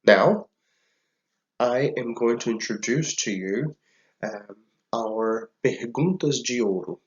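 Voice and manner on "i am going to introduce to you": ordinary talk, nothing marked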